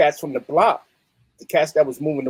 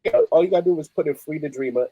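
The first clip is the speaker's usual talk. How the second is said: normal talking voice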